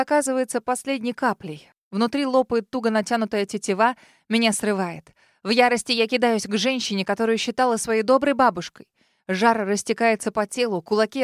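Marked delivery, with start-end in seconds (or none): none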